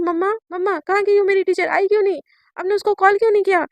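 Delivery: put-on girl demanding answers from her mother, impatient; demanding call. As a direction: call out to mom, annoyed.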